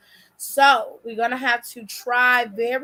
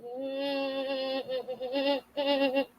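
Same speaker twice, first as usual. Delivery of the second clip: soft closed-mouth mm-mm hums, far softer; humming mm-mm reaction; 9 dB quieter, slower than usual. Retold or imitated. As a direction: hum mm-mm like you know the truth. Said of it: "I'm like".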